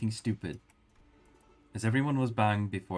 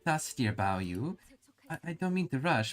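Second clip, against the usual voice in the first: higher, forced-sweet prodding; sweetly pushy mother; 10 semitones higher than usual. Acted. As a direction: hurry him out politely. The mother asking pointedly.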